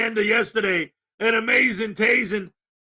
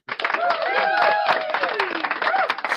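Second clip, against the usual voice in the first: very high squeaky giggle; squealing laugh. Real, not acted. Laughing at a viewer's joke.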